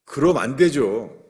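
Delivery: slow, loud no-nonsense scolding; modern-day scolding. Retold or imitated. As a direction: tell him flatly he can't do that. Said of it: "요새 말로 그런 거예요".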